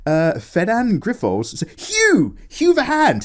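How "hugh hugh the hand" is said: high excited exclamation. Real, not acted. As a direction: cry out the name in delight. Excited recognition of character name.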